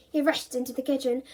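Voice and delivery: usual speaking voice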